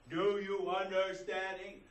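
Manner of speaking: loud, slow and high, mocking condescension; over-enunciate as if they can't understand English; mock talking down to a foreigner, loudly. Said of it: "You have to talk loud".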